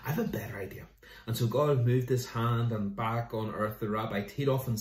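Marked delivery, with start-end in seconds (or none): 0.1-0.9 God dropping to a low whisper; hushed, scheming God, lower and softer; conspiratorial hush. Retold or imitated. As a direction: whisper it like a sly secret plan. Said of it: "God says".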